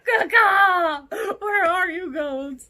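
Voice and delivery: high loud call to goats. Pretend. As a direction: yell for runaway goats. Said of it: "and be like".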